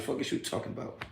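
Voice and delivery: muttered under his breath; muttered aside. Real, not acted. Brushing off a troll, muttered aside.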